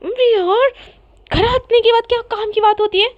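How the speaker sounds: high, loud exasperated protest. Acted. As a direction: act out an annoyed complaint. Acted irritated quote in story.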